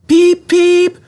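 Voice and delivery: high, loud train whistle peep; whistling. A narrator's reading. Edward whistles happily as he puffs away, high and loud. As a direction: toot it out like a little engine's whistle. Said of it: "whistled Edward".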